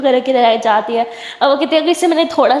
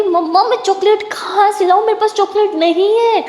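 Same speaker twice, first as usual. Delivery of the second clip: protesting; 7 semitones higher than usual. Acted. Jaz protests to mother.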